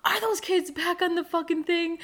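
ordinary delivery

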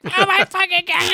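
very high tearful wail, imitated; mock crying. Husband imitates wife seeing the cheese, mock crying. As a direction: imitate an upset wife's high cry. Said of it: "she started to cry".

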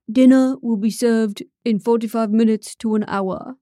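stuffy British butler announcing dinner; old British butler. A narrator's reading. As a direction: put on an old British servant's accent. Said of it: "speaking like an old British butler".

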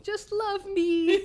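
high, laughing, half-crying plea. Pretend. Host playing unwanted sea foam to cohost, laughing plea. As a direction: beg to be loved through laughter.

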